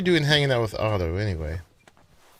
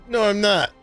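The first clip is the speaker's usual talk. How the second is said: louder, higher exasperated protest; indignant; 9 semitones higher, 5 dB louder than usual. Real, not acted. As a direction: insist it, fed up. Protesting game says in combat.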